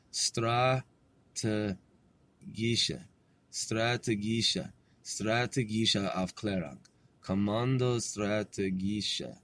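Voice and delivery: slow, low, soft careful German. Real, not acted. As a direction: say it very slowly, syllable by syllable, softly. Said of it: "I have to say that very slow".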